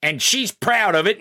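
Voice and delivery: high, slow sneering mock voice; drawn-out taunting impression of a bigot; mocking critics. Host mocking [name]'s right-wing critics in a high, slow sneer. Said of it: "But they can be like".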